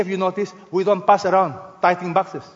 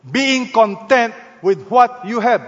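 slow, emphatic command, each word weighted; forceful command; 4 semitones higher, 4 dB louder, slower than usual. Acted. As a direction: read it out as a firm order. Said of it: "That is the force of a command".

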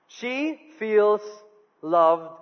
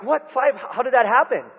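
shocked; 5 semitones higher than usual. Retold or imitated. Husband shocked by low rating.

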